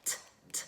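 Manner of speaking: "T, t," as a whispered t sound; letter sound t. Shown as a demonstration. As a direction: breathe the letter sound "t" out, unvoiced. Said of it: "our letter of the week".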